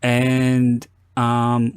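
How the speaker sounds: ordinary talk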